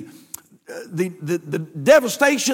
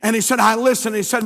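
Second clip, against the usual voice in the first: ordinary talk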